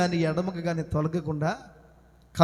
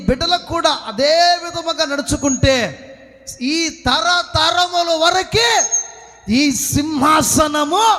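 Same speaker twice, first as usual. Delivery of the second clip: higher-pitched, ringing pulpit cry; full-force preaching; 7 semitones higher, 4 dB louder than usual. Acted. Preacher declaring believers a line of kings, full-force preaching. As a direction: proclaim it to the whole congregation.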